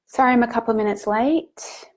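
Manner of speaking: usual speaking voice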